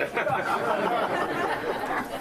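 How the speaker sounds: hearty laugh; loud laugh. Real, not acted. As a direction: burst out laughing. Big laugh.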